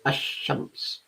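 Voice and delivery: "ash-shams" with the L silent, straight into a doubled sh; lam dropped. Shown as a demonstration. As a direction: say "ash-shams", no L sound before the sh. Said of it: "lam is not pronounced".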